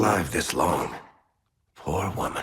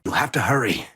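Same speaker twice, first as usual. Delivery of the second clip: normal talking voice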